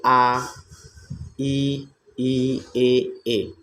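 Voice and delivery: आ ई ए drawn out with spread unrounded lips; vowel demo. Shown as a demonstration. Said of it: "यानी आवृत्तमुखी स्वर". (आ ई ए को ओंठ फैलाकर खींचकर बोलना)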